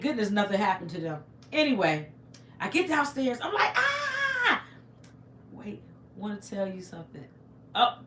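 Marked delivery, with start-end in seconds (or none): 3.8-4.6 high-pitched acted scream of panic; re-enacted scream. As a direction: scream as if fleeing, half laughing. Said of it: "I'm screaming to high heaven".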